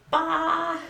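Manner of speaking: loud, high mimicked "Bah!"; mocking imitation. Woman imitating people who tease her accent, loud mocking bleat. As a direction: bleat it out loudly, poking fun. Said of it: "a lot of people go like".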